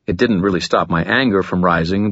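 ordinary delivery, nothing marked

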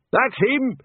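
high, urgent cry of recognition; calling out. A narrator's reading. Watchman spotting the man they are chasing, urgent cry.

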